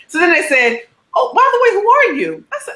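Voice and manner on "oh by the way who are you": high-pitched, brightly nosy question; mock-curious producers. Producers turn to the unknown woman, mock-curious and high. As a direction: ask it high, as an afterthought. Producers finally notice her.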